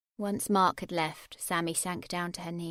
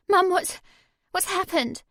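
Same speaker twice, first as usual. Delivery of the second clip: high, louder anxious question; alarmed; 11 semitones higher, 10 dB louder than usual. A narrator's reading. Sammy to her mum, worried and higher. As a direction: ask in sudden alarm.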